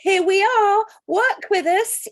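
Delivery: high, loud cheery greeting; voicing the plants. Garden plants greeting her as she walks in, high and loud. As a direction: call out brightly as the garden plants. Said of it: "they're saying".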